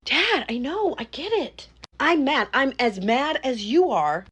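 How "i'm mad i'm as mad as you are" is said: mad. Acted. Daughter argues with angry father.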